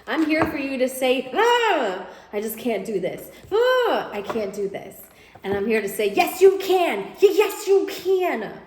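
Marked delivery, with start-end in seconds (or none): 1.3-5.0 overwhelmed groan. Acted. Voicing a client's overwhelmed doubt. 6.1-8.5 high and loud "yes, you can" pushed hard; rally them like a coach at the sideline; loud, high cheering insistence; emphatic encouragement. Acted. Cheering the client on.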